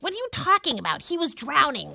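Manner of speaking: high-pitched, rushed objection; indignant protest. Acted. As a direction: protest the doubt, voice jumping up. Accused of lying, protests.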